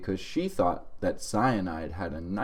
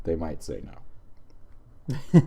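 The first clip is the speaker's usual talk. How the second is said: slow deadpan; 4 semitones lower, much slower than usual. Shown as a demonstration. Repeating punchline slowly, deadpan.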